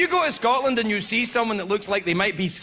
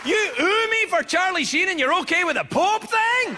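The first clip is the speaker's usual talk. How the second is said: hammed-up shock at the crowd; mock outrage; 9 semitones higher than usual. Real, not acted. Comic yelling at the audience in mock disbelief.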